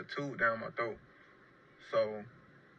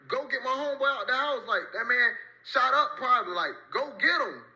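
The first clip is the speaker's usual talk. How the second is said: much higher, louder urgent begging; frantic plea; 12 semitones higher, 4 dB louder than usual. Retold or imitated. Wounded, yelling at paramedics to fetch his shot friend, frantic higher plea. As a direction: beg them to fetch him right now. Refusing stretcher, pleading for friend.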